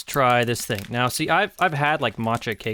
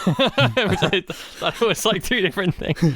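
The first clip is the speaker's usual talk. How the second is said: laughing; 8 semitones higher than usual. Real, not acted. Laughing at misreading the label.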